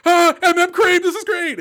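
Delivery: high, loud gushing squeal of excitement; mock ecstatic high voice; excited fan squeal. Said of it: "the level of excitement that was like".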